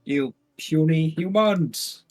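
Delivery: slow, booming, high-pitched taunt; booming arrogant alien overlord. Acted. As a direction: lord it over tiny mortals. Giant character taunts the party.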